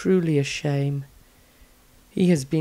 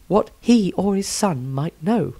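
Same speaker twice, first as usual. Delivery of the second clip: normal talking voice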